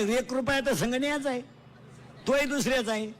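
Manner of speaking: loud, high-pitched sermon delivery; preaching at full force. Acted. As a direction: proclaim it to the whole crowd.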